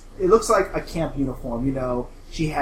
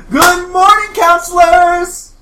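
high, loud, drawn-out cheer; hyper wake-up call; 11 dB louder, slower than usual. Acted. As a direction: greet the room like an overexcited camp leader. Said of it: "so hyper and ready to go".

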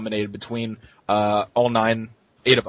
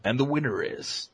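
suspenseful awards presenter; 2 semitones higher, 6 dB quieter than usual. Retold or imitated. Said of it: "He'll do his little".

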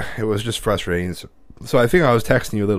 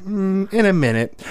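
put-on aloof little girl; 9 semitones higher, slower than usual. Daughter brushing boy off.

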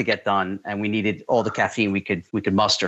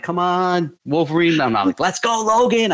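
hyped shouting; 8 semitones higher than usual. Retold or imitated. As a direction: pump him up, yelling. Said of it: "I start screaming like crazy".

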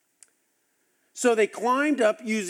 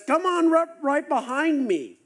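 eager Jonathan; 6 semitones higher than usual. Retold or imitated. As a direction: call him along, raring to go. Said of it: "Jonathan turns to him and says".

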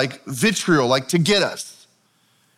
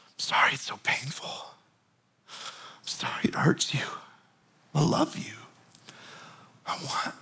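soft, hushed, apologetic whisper; tender whisper; 7 dB quieter than usual. Acted. Jesus whispering comfort to grieving sisters, soft and tender.

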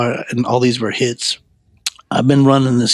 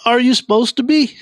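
startled question; 11 semitones higher than usual. Retold or imitated.

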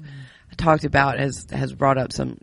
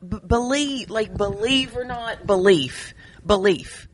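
BELIEF repeated higher, each time set apart; emphatic enunciation; 6 semitones higher than usual. Real, not acted. Correcting a friend who misheard the podcast name, emphatic enunciation. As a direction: drive home the word BELIEF, insistently. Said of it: "You don't have to yell at me".

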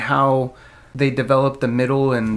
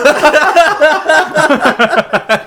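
loud, high burst of real laughter; delighted laughter; 13 semitones higher, 7 dB louder than usual. Real, not acted. Hearing his accidental accent, real delighted laughter. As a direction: crack up completely.

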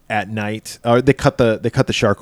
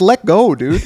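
urging yell; 8 semitones higher, 5 dB louder than usual. Retold or imitated. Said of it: "I'm like".

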